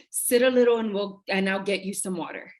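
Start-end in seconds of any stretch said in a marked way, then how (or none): none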